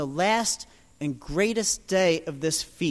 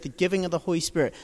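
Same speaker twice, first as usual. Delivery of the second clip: ordinary talk, nothing marked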